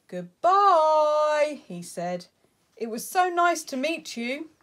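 the owl calling goodbye to the bear as it flies off. Acted. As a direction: call out goodbye like a friendly owl. Owl calls farewell.

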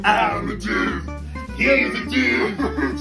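ordinary talk

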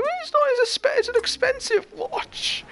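mock the posh boy's petulant whine; hammed-up high whimper about the watch; whiny rich kid. Boy whines to head of year.

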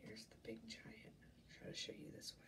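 soft aside whisper. Real, not acted. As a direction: whisper it behind the book. Aside while showing picture.

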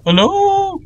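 very high, drawn-out nervous call; sing-out hello into the unknown. Real, not acted. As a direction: call out on a held high note. Calling out into the dark.

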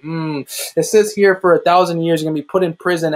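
ordinary delivery

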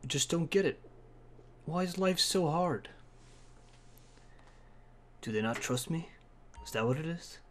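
very soft, low self-questioning; hushed lament. Pretend. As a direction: murmur sorrowfully to yourself. Character laments alone, hushed.

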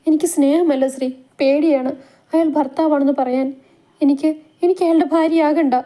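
put-on young wife telling Sree of her fear, mildly frightened; frightened pleading. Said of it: "ഭയത്തോടെ തുറിച്ചു നോക്കുന്ന".

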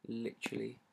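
ordinary talk

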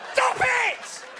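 high squealed protest, half laughing; mock shriek. Mum squealing at her teasing son, high and laughing.

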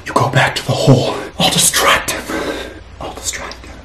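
hushed urgent whisper. Pretend. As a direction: whisper so the cat can't hear. Mouse story, hiding from cat.

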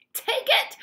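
very high, loud urgent order; excited shout. A narrator's reading. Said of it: "shouted Mister Wonka".

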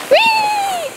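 very high drawn-out whee; sledding cheer. Real, not acted.

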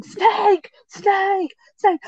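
high-pitched mock yell of warning; retold scream. Irish girl sprinting down the track, warning everyone: mock high yell. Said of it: "she was screaming".

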